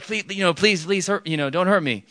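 high, put-on cowering plea; mock begging. Preacher imitating someone afraid of being hurt, mock begging. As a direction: plead high as if fearing harm. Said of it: "it's the same voice as if you're like".

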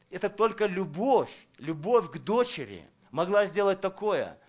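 ordinary talk, nothing marked

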